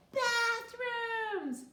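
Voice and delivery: put-on high, loud child's voice; child calling out a guess; eager suggestion. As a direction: chirp it out helpfully. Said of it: "Beanie suggested".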